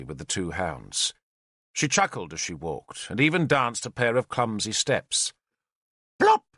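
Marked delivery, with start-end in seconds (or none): 6.2-6.4 called aloud. A narrator's reading. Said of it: "she called aloud".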